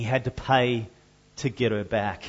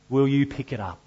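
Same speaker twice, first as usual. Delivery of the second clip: ordinary talk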